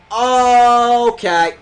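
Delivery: loud drawn okay. Acted.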